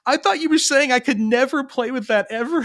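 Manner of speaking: put-on high-pitched child's protest; upset little kid. Son misunderstanding dad's rule, high mock-upset protest. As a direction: imitate an overdramatic small boy. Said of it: "his little brain was like".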